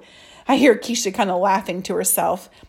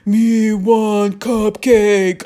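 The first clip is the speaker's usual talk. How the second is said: slow, loud, put-on monster growl; a boy hams up Cookie Monster for a cupcake; 7 dB louder, much slower than usual. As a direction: drag it out like a hungry monster. Kid imitating Cookie Monster.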